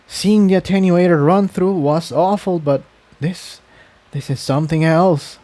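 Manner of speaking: barely holding it together; lightly voiced shaken young mare; shaken and upset. Shaken after seeing dead pony.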